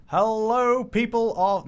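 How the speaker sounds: hammed-up radio intro; show host intro. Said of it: "no, that's rubbish".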